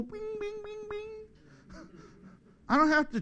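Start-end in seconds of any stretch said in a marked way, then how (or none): 0.0-1.8 high, soft mock ding; a high little chime for the light coming on; light switching on.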